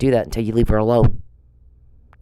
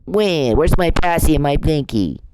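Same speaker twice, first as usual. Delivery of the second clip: high mocking crybaby whine; mocking a harasser as a whiny toddler; whiny baby mockery; 5 semitones higher than usual. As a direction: mimic him as a big baby. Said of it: "when she complains about it".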